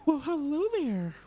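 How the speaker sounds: high cooing pet voice; cooing at a puppy. Real, not acted. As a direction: coo at the dog in a high sing-song. Greets a dog in game.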